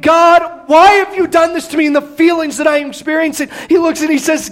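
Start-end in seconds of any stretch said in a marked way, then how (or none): 0.0-1.9 louder, higher mock complaint to God; pretend crying out. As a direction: cry out at God, half acted. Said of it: "instead of crying out and saying".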